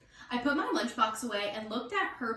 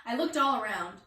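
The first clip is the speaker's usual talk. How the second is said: plain reading voice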